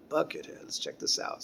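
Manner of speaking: muttering. Retold or imitated. Quiet inner thought.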